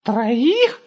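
put-on high squeaky boy voice; startled high-pitched little man; shocked squeak. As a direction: squeak it out in disbelief.

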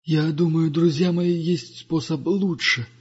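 lightly voiced wise old pilgrim; Duga's voice. (голос мудрого странника)

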